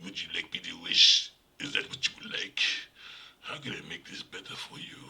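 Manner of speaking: a hint of a smooth, creepy genie. Imitating the original Wishmaster offering wishes, lightly creepy. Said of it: "it's like".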